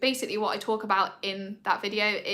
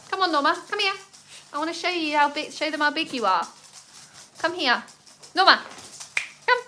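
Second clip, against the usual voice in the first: high, louder coaxing call; calling the dog; 7 semitones higher, 5 dB louder, faster than usual. Real, not acted. Owner coaxing her puppy Norma to the camera, high call. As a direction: coax the puppy over, bright and high.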